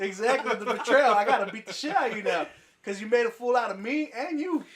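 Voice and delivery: hammed-up betrayed guy; mock outrage. Explaining to friends why guys get angry, with mock outrage.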